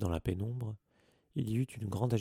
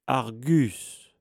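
commanding call; 2 semitones higher, 9 dB louder than usual. A narrator's reading. Headmaster cuts off the caretaker.

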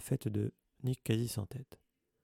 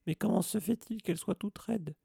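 a much higher, pleading caretaker; distraught Filch; 10 semitones higher than usual. A narrator's reading. Caretaker asks Dumbledore why his cat is stiff, distraught. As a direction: beg for an answer.

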